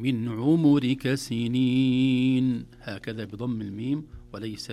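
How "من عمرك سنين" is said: من عمرك with the م of عمرك given damma, slow; slow Quranic chant of عمرك with the م voweled u, not still; recited Quran style. Shown as a demonstration. (بضم الميم، مرتلة ببطء)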